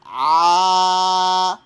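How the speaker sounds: loud open-throat "Ah" like at the dentist; open wide ah. Shown as a demonstration. Teacher showing how wide to open the mouth, loud ah. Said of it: "Open wide".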